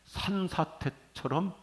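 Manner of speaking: 산사태처럼 stretched slow and lifted high; drawn-out emphasis. Shown as a demonstration. Pastor savoring the word 산사태처럼 for the church, slow and lifted. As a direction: lean into 산사태처럼, slowly. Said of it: "제가 쓰는 단어".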